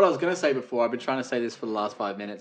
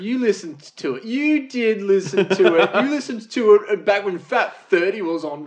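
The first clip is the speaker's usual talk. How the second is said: higher, louder, mock-accusing taunt; teasing accusation; 8 semitones higher, 8 dB louder than usual. Real, not acted. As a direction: needle your mate about his radio habit. Teasing friend about radio.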